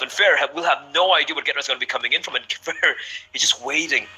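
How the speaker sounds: esports caster. Real, not acted. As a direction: build the excitement through the clutch. Hype clutch play-by-play.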